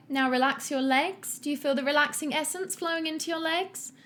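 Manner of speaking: high, chirpy exercise-class leader; perky relaxation coach. Acted. Julie leading Paul through relaxation, high chirpy coaching. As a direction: lead the class with sunny cheer.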